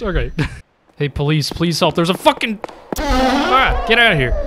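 ordinary talk, nothing marked

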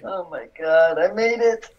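high relieved cry; relieved laughter. Real, not acted. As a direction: gasp it out, finally safe. Survives after near death.

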